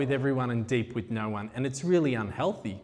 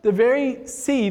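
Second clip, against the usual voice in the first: emotional; 6 semitones higher than usual. Real, not acted. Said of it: "I'm finding myself getting very emotional".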